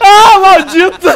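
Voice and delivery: drawn-out loud curse. Real, not acted. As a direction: yell it at the killer. Caught by killer.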